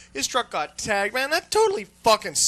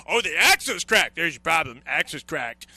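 mock mechanic; 2 semitones lower, 4 dB louder than usual. Mocking user diagnosing car.

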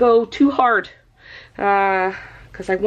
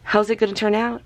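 ordinary delivery

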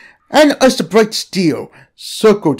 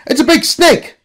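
much higher, excited voice; alarmed; 10 semitones higher than usual. Acted. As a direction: warn them, wide-eyed. Jack answers in alarm.

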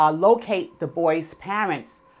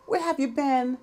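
tearful; 7 semitones higher than usual. Retold or imitated. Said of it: "He looks at her tearfully".

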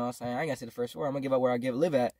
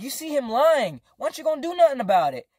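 high-pitched pressing demand; indignant protest; 9 semitones higher, 4 dB louder than usual. Retold or imitated. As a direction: retell an exasperated challenge to mom. Retold protest to mom.

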